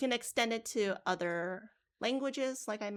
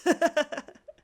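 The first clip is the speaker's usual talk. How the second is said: burst of laughter; 8 semitones higher, 11 dB louder than usual. Real, not acted. Laughing at students' antics.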